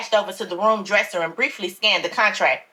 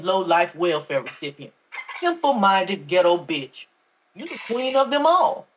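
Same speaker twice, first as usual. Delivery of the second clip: scornful pastor; 5 semitones lower than usual. A narrator's reading. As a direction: look down on her, every word a sneer. Pastor insults her.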